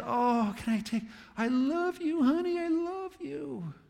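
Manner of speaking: hammed-up crazy cat lady cooing; gushing cat lady. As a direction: coo over the ugly cat like a baby. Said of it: "And the cat's going".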